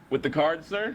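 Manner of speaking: mock-innocent question, rising; sarcastic. Sarcastic soldier asks the officer about the card, mock-innocent. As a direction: needle the officer with fake politeness. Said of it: "My character is very sarcastic".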